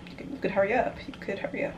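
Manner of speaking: usual speaking voice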